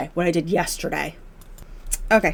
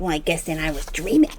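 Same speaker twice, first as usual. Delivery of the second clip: mildly snippy retort, lower voice; 4 semitones lower than usual. Retold or imitated. Dad retorts to daughter that he was dreaming, mock snippy. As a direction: imitate a huffy, snippy comeback. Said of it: "he got all snippy".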